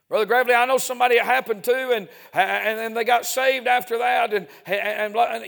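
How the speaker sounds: loud, high, hurried protest; imitated eager objector. Mimics a congregant's eager objection.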